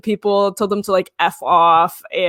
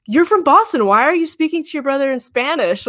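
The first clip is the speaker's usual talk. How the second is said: incredulous retort; 6 semitones higher than usual. Pretend. Mock retort to her claim.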